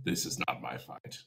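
low, soft, unvoiced aside; quiet dismissive giant. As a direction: step back, murmured. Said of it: "The Frost Giant".

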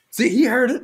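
high, loud mimicked yell; imitated phone yelling. Shopper telling her caller the stranger heard, mock high yell. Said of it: "she's, like, yelling over the phone".

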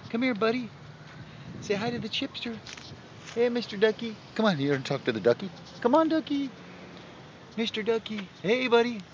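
high, gentle coaxing call to a duck; soft coaxing. Real, not acted. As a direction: lure the duck over softly.

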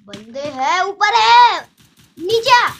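loud urgent warning cry; alarmed shout. Real, not acted. Enemies spotted above, alarm.

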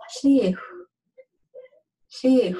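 she with the vowel of machine; E sound. Shown as a demonstration. Teacher showing the letter I, she with an ee sound. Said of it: "it's an E sound".

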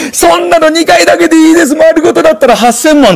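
high, brisk landlady naming her price; landlady quoting the price, high and brisk; shrewd landlady. Retold or imitated. Landlady answers, voiced high.

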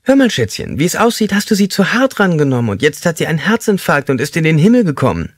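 high, sugary mock mother voice; doting mom. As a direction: mimic a mother excusing her son. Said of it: "sie hätte gesagt".

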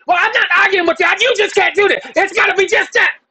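mock insistent postal clerk. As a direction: play the clerk who won't back down. Clerk insisting, retold quarrel.

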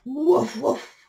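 short, loud woofs; barking dog. Acted. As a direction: bark like a dog. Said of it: "barked the dog".

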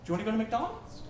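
imitating mom's soft, hopeful suggestion; imitated gentle offer. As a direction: softly float the question upward. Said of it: "as we're crying and in tears, she says".

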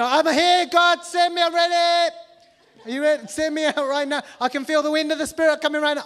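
high, loud mock fervor; mock zealot begging God to send him now; hammed-up zealous cry. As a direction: shout it like a fevered zealot.

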